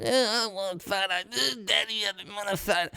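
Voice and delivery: put-on high-pitched whining child; annoying whiny kids. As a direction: whine like a kid pestering Daddy. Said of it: "really annoying".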